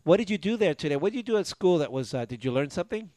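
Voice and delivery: usual speaking voice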